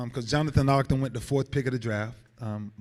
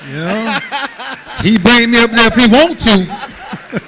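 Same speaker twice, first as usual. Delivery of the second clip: laughing hard through the words; 10 semitones higher, 16 dB louder, slower than usual. Real, not acted. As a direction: crack up while teasing about the job. Laughing at his own joke.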